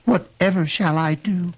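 put-on high, slow girlish lament; distressed cry. A narrator's reading. The girl despairing over her task, distressed cry. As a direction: draw out a high, helpless plea. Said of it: "cried the girl".

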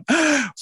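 sharp, high intake of breath; mock gasp. Storyteller reaches the forest in his joke, mock gasp. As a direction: gasp in pretend surprise.

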